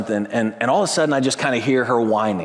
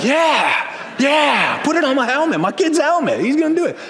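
mock eager fan; 12 semitones higher, 3 dB louder than usual. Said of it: "Some of you are like".

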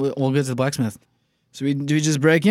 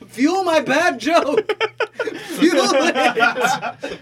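high, slow, laughing plea; 12 semitones higher, much slower than usual. Real, not acted. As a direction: squeeze it out through giggles. Table cracking up at joke.

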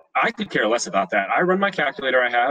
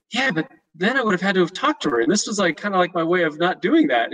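higher, whiny protest; defensive protest; 7 semitones higher than usual. Retold or imitated. Husband protesting to his wife, higher and whiny. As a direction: defend yourself to her, pitched up.

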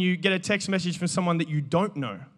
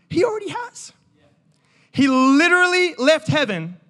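emphatic preaching rise; 8 semitones higher, 4 dB louder than usual. Real, not acted. Preaching climax.

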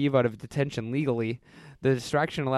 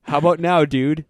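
louder mock threat; menacingly; 4 semitones higher, 9 dB louder than usual. Steve threatening BWK at gunpoint, louder hammed-up menace. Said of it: "Steve said menacingly".

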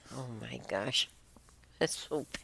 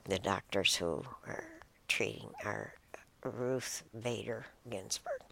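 ordinary talk, nothing marked

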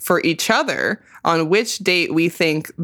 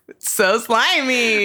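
ordinary talk, nothing marked

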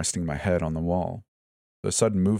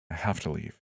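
soft, dazed whisper; 7 dB quieter than usual. A narrator's reading. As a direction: say it to yourself. Repeats, fading.

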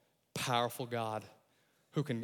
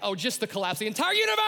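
sarcastic; 8 semitones higher, 7 dB louder, faster than usual. Real, not acted. Sarcastic punchline.

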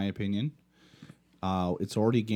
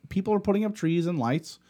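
worked up; 9 semitones higher, 3 dB louder than usual. Real, not acted. Ranting about Christmas.

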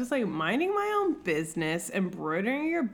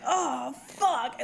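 put-on childhood awe; 6 semitones higher than usual. Eight-year-old self reacting.

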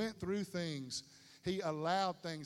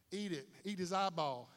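ordinary delivery

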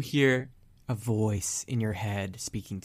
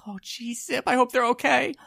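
high, fretful little voice, raised well up; worried sidekick voice; 11 semitones higher than usual. Acted. Yogurt frets to Zippy about the others, high and worried. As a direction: fret over the missing friends in a raised pitch. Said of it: "he's just like".

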